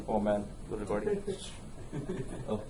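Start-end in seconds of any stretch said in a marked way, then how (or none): none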